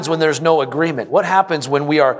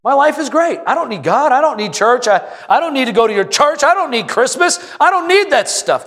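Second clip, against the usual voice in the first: high, dismissive mock voice, rising higher; a careless know-it-all shrugging off church; smug brush-off; 7 semitones higher than usual. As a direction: brush off the preacher like you're fine without God. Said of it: "The sentiment is".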